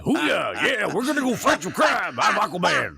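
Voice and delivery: loud, high Southern trucker yell; hammed-up good ol' boy hero. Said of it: "chose the voice of a Southern trucker".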